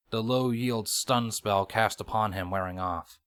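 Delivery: ordinary delivery, nothing marked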